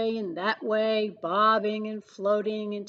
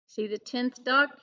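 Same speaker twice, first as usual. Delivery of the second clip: ordinary delivery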